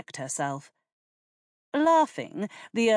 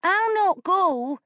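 put-on high, loud, slow child's shout; 7 dB louder, slower than usual. A narrator's reading. Janet refusing her father, loud high shout. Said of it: "she shouted".

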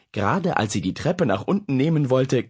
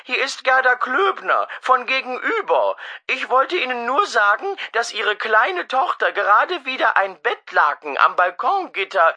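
neighbour on the phone; 13 semitones higher, 3 dB louder than usual. Acted. Neighbour on telephone.

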